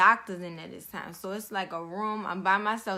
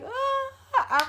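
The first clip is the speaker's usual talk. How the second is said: high-pitched mock cry; imitated crying wail; 4 dB louder than usual. Patient hearing she needs an emergency C-section, imitated crying. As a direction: act out a brief, high wail. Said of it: "I bust out crying, I'm hollering, crying".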